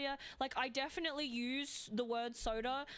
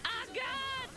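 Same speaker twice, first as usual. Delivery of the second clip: dismayed cry; 12 semitones higher than usual. Real, not acted. Overwhelmed by boss fight.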